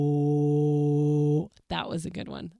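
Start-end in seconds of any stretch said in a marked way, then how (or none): 0.1-1.5 a longer, louder, lower imitated horror sting; movie scare sound. Second try at the Nun's scare sound, doing it better. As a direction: commit fully to the scary movie sound effect. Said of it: "Okay, I did pretty good".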